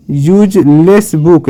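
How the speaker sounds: "Useless बुक" slowly, piece by piece; slowly sounded out. Shown as a demonstration. Teacher spelling it out for learners, useless बुक slow and split. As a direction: stretch "useless बुक" into use-less-book.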